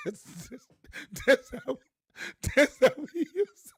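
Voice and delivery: squeaky laughing words. Real, not acted. Preacher cracking up at hometown imitation. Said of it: "laughing at own imitation".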